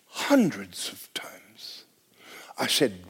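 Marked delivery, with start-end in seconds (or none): 0.1-1.8 whisper. Retold or imitated. Man answers pastor, retold.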